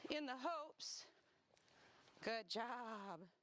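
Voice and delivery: normal talking voice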